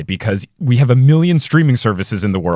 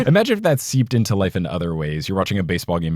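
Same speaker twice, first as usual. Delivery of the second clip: ordinary talk, nothing marked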